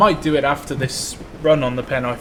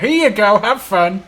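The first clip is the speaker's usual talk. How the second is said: cheery presenter; 7 semitones higher, 6 dB louder than usual. Pretend. Mock caption for livestream.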